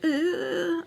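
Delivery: high-pitched "ugh"; imitated exasperated groan. Storyteller not knowing what to do, high helpless groan. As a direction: groan as if at a loss. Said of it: "I'm just like".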